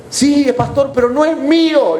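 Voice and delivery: high-pitched imitation of a reluctant woman; mock-distressed high voice; retold protest. Acts woman's protest to pastor.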